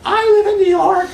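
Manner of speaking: high, loud, laughing mock voice; her voice. Imitating a colleague who boasts about New York, laughing and high. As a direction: squeal it through a laugh, half-hearted imitation. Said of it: "I can't do her voice".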